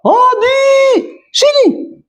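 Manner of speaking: high, shouted call across a distance; mock shout calling a boy over; calling out loud. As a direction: yell the name to someone far off. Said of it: "Angkat suara".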